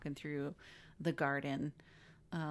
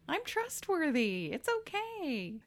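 sing-song parody of an earnest actor; Mark Wahlberg; 11 semitones higher than usual. Retold or imitated. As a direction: coax soothingly in a silly high voice. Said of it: "He's like".

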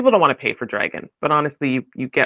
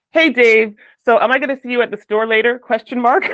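bright, louder, quick voicemail with the command read out; voicemail dictation habit; 8 semitones higher, 5 dB louder than usual. Retold or imitated. Leaving Dave a voicemail, hurried and louder. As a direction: rattle off a cheery message to Dave, forgetting it's not dictation. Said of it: "you leave voicemails and you're like".

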